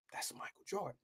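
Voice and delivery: breathless whisper of recognition; awed whisper. Retold or imitated. Fan recognizing Michael Jordan at the blackjack table, whispering. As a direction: whisper it in disbelief.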